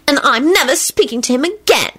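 high, determined declaration; resolute. A narrator's reading. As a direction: make a final stubborn vow. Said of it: "she finished resolutely".

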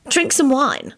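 high-pitched, bewildered offer. A narrator's reading. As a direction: offer it, at a loss. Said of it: "told him in a bewildered voice".

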